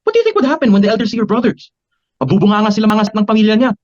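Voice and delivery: high-pitched, upset defence; indignant protest. Acted. Acted quarrel, defending the elders.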